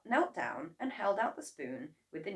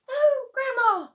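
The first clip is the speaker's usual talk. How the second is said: very high, loud plea; pleading boy; 11 dB louder than usual. A narrator's reading. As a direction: try to explain. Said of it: "George said".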